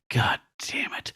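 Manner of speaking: whispered under the breath; muttered curse. Retold or imitated. The Thing cursing under his breath at the hippie. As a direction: hiss it out under your breath, fed up. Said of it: "He's like".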